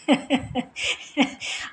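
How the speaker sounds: quiet, airy suppressed laugh; stifle a giggle; hushed laugh at a mishap; soft giggle. Real, not acted. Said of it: "मुझे हंसी भी आ रही थी".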